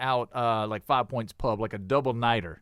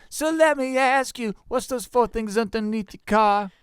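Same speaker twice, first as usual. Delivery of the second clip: high, slow exaggerated country accent; 8 semitones higher, 5 dB louder than usual. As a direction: lay on a thick Southern country accent. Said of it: "put it on your most country accent".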